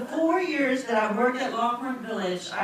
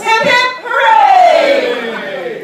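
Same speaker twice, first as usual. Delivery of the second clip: high, loud rally cry; cheering shout; 10 semitones higher, 12 dB louder, much slower than usual. Real, not acted. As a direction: whip the crowd up with a cheer. Leading the crowd cheer.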